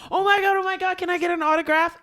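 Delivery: very high, loud mock squeal; gushing fan. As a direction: squeal like a starstruck autograph seeker. Acting out a gushing autograph seeker.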